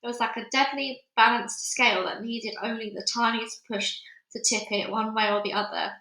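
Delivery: usual speaking voice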